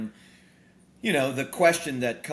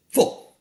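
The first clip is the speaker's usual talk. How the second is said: "Full." said much louder as a punchy one-word echo; emphatic repeat; 11 dB louder than usual. Shown as a demonstration. Banker's news that the debt is paid, a loud "Full.". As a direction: slam down on "Full.". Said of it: "paid your debt off in full".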